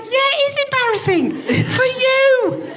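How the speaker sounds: high gloating reply; high, slow, laughing putdown. Retold or imitated. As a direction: savour the comeback, laughing. Retold putdown.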